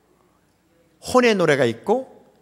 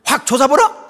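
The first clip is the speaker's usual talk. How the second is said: Jeolla dialect; 8 semitones higher, 5 dB louder than usual. Retold or imitated. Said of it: "전라도 말은요".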